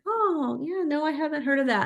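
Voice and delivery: a hint of a clueless young fan; imitating a younger person. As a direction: toss it off like someone who never heard of it. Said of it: "some of the younger people are like".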